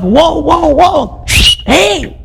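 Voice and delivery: high excited shouts of surprise; excited whoa. Acted. Fisherman startled by the huge catch, high excited. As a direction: call out as the net nearly breaks. Fishermen see net full.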